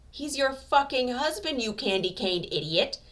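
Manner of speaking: loud, slow yell with a laugh in it; laughing scream. A narrator's reading. Katsuki yelling at the annoying rival, laughing scream. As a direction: scream it at him, half laughing. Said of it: "Katsuki screams, kind of laughing".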